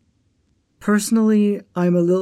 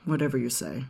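sullen mutter; 4 semitones lower, 7 dB quieter, faster than usual. Acted. Sulky reply to the manager.

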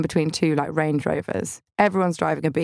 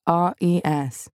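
spelled-out reading; slower than usual. Pretend. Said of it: "standing up in a church and going".

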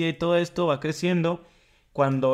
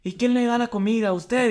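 higher mock yell at the son; playing the father yelling his questions; retold yelling father; 9 semitones higher, slower than usual. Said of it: "le empieza a gritar". (grito imitado del padre, más agudo)

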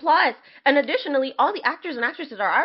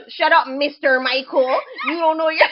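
put-on sassy teen, laughing through it; mock a rude student while cracking up; giggling through a bratty jab at the teacher; laughing mock sass; 3 semitones higher than usual. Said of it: "dish the teacher".

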